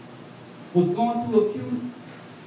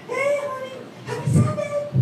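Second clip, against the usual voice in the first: imitating mother-in-law. Voicing mother-in-law on phone.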